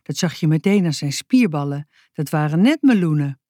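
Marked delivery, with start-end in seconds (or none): none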